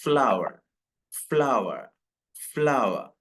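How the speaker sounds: ഫ്ലവർ in two syllables like flower, final r silent; correct pronunciation. Shown as a demonstration. Teacher modelling the right form for students, ഫ്ലവർ with silent r. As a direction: say ഫ്ലവർ as flau-uh, no r. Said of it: "ഏകദേശം അതേ ഉച്ചാരണമാണ് ഇതിന് വരുന്നത്".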